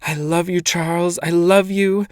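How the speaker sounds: high, rising cry of love. A narrator's reading. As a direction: call to him with everything. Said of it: "she cried".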